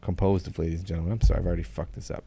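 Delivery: ordinary talk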